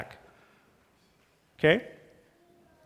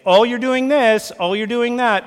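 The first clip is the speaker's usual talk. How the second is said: mock accusing spouse; 7 semitones higher, 6 dB louder than usual. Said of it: "start pointing them out and saying".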